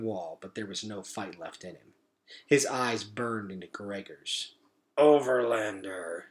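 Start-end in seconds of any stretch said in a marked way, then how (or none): 5.0-6.2 slow, dying gurgle of a rat; gurgling. Acted. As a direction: choke the word out. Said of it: "he gurgled".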